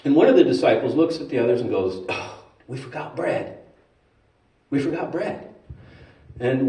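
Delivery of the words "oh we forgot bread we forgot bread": soft, furtive confession; quiet, sheepish aside to friends; hushed admission. Acted. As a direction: confide a blunder under your breath. Disciple confides a blunder to others.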